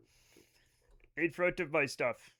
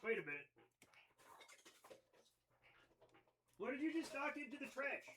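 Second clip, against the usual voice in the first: hushed disbelief; 5 semitones higher, 19 dB quieter, faster than usual. Real, not acted. Cat knocks thing into trash.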